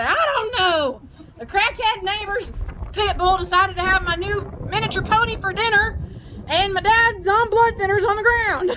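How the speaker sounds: high-pitched, exasperated caller; hammed-up frantic report; frantic retelling. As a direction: re-enact a flustered emergency call, pitched high throughout. Retold frantic emergency call answer.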